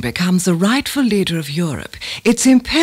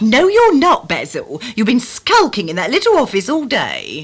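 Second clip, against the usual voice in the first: nagging wife; 4 semitones higher than usual. Acted. Wife nags husband.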